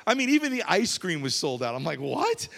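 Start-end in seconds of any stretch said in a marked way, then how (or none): 2.0-2.5 high, startled what; a sudden high disbelieving what; mock surprise. Telling the crowd about Walmart, mock surprised what. Ice cream sold out.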